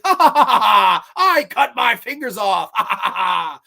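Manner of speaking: mock maniac laughing through the line; manic laughter. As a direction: cackle like a deranged old woman. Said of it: "And she's like".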